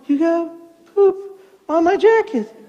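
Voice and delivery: mock-stunned slow delivery; stunned realization. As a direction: say it haltingly, pausing between words. Deflated grandpa after the chaos.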